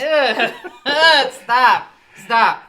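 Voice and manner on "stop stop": loud laughing protest. Real, not acted. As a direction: shout at him to quit. Begging him to quit laughing.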